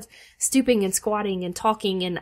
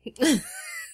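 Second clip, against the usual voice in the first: shrieking, squeaky giggle; high squealing laugh; 3 dB quieter than usual. Real, not acted. Laughing at being teased.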